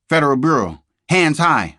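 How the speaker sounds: loud, high shout of an FBI agent; agent's shout. A narrator's reading. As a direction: bark it as a sudden arrest.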